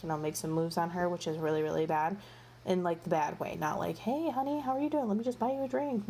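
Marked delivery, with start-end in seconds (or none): 4.0-6.1 higher, sweet-talking pickup line; hammed-up sugary come-on; mock smooth flirt. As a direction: play a cheesy charmer offering a drink. Contrasting sleazy guy come-on.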